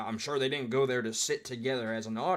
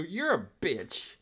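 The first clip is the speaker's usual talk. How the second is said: mock angry woman; 5 semitones higher, slower than usual. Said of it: "has just turned into".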